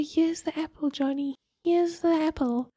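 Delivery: put-on high, singsong offering to a child; tutor addressing child. Tutor hands little Johnny an apple of information, high coaxing voice.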